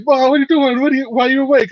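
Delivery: high, mock-startled gangster voices; surprised gangsters. Son's friends surprised mom is awake, mock-startled and high. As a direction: play the boys caught off guard by mom. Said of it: "They're like".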